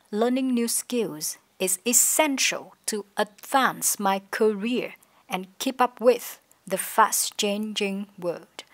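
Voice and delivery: slow, with weight on "essential", "advance my career", "keep up with"; stressed key words. Shown as a demonstration. Teacher demonstrates sentence intonation, slow stress on "essential" and "keep up with". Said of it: "có thể nhấn mạnh vào những từ quan trọng".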